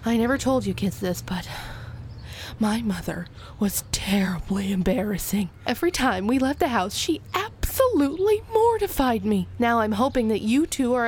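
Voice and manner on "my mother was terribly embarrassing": hushed confiding whisper. Acted. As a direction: drop to a secret whisper. Mom confides in hushed aside.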